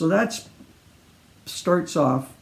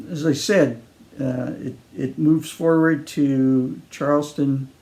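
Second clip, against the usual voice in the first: ordinary delivery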